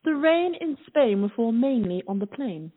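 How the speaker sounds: crisp British accent, repeating the phrase. Shown as a demonstration. Friend asks her to repeat a phrase, crisp British accent. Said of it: "can you do me a favor? Say".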